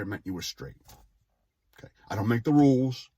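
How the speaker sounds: normal talking voice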